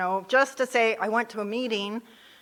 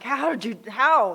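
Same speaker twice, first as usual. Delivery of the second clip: incredulous; 4 semitones higher, 5 dB louder than usual. Retold or imitated. Disbelief at sober newcomers.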